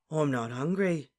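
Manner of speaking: slow, listless murmur. A narrator's reading. Dazed friend turns down breakfast, listless. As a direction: say it as if lost in a daze.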